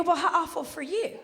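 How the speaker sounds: pointed fake pity thrown back; mock sympathy comeback. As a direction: echo their pity right back at them. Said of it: "I go".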